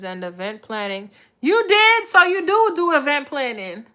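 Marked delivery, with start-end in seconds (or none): 1.4-2.1 much higher, louder "You did?"; genuinely taken aback; sudden high surprised question. Real, not acted. Surprise at viewer's news. 2.1-3.9 excited. Real, not acted. Surprise at viewer's news.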